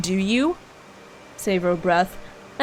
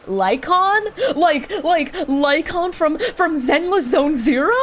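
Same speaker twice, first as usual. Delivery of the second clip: higher, louder amused surprise; wait, that name?; surprised recognition; 7 semitones higher, 3 dB louder, slower than usual. Real, not acted. Streamer hearing a familiar game name, amused surprise.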